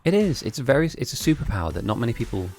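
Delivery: ordinary delivery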